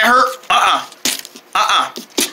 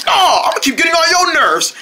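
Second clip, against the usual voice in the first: defiant taunt; 8 semitones higher than usual. Acted. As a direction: pitch it up and goad them. First taunt, repeated after "what?.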